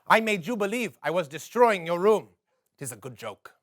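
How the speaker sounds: ordinary talk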